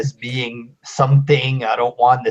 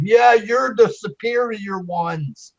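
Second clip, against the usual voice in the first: ordinary delivery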